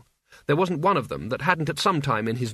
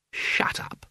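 hissed whisper; 4 dB quieter than usual. A narrator's reading. Albert glares.

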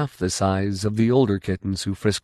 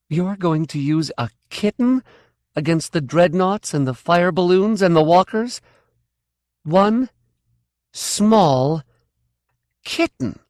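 high, rising scorn ending in a breath; scornful disbelief; 9 semitones higher than usual. Acted. Kay scoffing at Tough's plan, scornful disbelief. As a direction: stress each word of one small kitten.

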